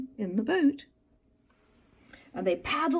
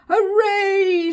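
loud, high cheer of joy; shouted; 10 semitones higher, 10 dB louder than usual. Acted. Little Beaver and friends cheer together, a loud shout. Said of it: "shouted Little Beaver".

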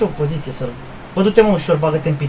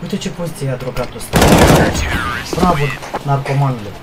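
sarcastic whisper; 9 semitones lower than usual. Real, not acted.

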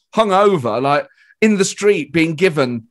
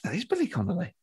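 hushed recognition; 3 semitones higher, 10 dB quieter, faster than usual. Retold or imitated. Comic nudging a friend on spotting a famous stand-up, hushed. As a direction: say it under your breath, just to your friend. Spots the star, aside to friend.

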